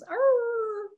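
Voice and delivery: high howling dog moan; mock dog whine; dog moan impression. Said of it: "That was a very good impression".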